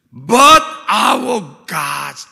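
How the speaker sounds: But our God, very slow and loud; But our God proclaimed high and drawn out; emphatic But our God. Acted. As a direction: space out But our God, each word hit hard. Preacher repeats answer in English.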